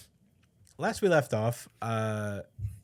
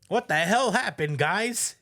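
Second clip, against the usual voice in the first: higher, louder furious boss yelling; mock angry yell; 10 semitones higher, 6 dB louder than usual. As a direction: chew out the useless staff. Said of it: "Emu was tight".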